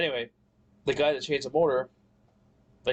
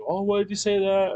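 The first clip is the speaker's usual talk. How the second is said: higher, louder mock of mom's dismay; imitating mom; 8 semitones higher, 4 dB louder than usual. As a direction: voice mom's alarmed question, pitched up. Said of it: "my mom was like".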